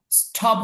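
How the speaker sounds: স্টপ with a breath puff after T, like ঠ; aspirated T. Shown as a demonstration. Teacher shows students the mistaken reading, স্টপ with ঠ sound. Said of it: "এখানে স্টপ হবে না".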